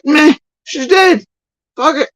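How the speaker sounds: imitating someone who has given up; dismissive attitude. Imitating a searcher who shrugs off the missing girl, dismissive. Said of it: "Sort of attitude".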